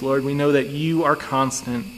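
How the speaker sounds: ordinary talk, nothing marked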